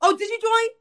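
high delighted "Oh, did you join?"; pleased surprise. Real, not acted. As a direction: perk up, happily surprised. Surprised at friend joining group.

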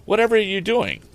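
a startled housekeeper jumping up; alarmed housekeeper. Acted. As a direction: housekeeper shrieking at the tablecloth. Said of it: "she sprang up in some alarm".

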